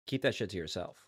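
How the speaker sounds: low, soft grumbled rule; muttered aside. Pretend. Motel guest warns others off, low soft grumble. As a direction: grumble it low under your breath.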